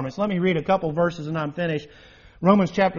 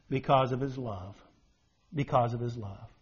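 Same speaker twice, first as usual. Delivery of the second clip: soft repeated answer; 7 semitones lower, 7 dB quieter than usual. Real, not acted. Repeats answer softly, preaching.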